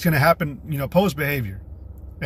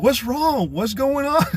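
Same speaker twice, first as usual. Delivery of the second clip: higher-pitched mock innocence; puzzled husband, lightly voiced; 8 semitones higher, slower than usual. Husband to his screaming wife, higher mock-puzzled questions. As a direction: play a husband asking what happened. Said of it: "he's just like".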